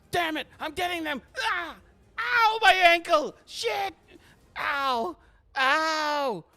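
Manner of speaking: high, strained mock yelps of pain; hammed-up pained cry, dragging out each "ow"; hurt ankle yelping. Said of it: "not the cleanest of landings".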